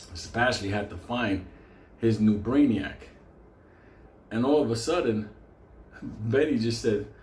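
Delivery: normal talking voice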